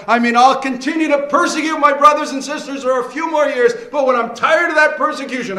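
lightly voiced careless convert; careless believer voice. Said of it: "He didn't have this false idea".

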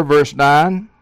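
normal talking voice

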